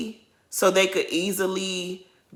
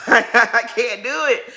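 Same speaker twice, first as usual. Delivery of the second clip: laughing; 3 semitones higher, 5 dB louder, slower than usual. Real, not acted. Laughing at the drama.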